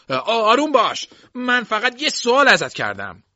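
loud, high, stumbling protest; alarmed pleading. A narrator's reading. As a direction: urgently try to calm him mid-struggle. Calming a struggling man mid-fight.

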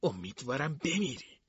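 soft, muttered curse; under her breath. A narrator's reading.

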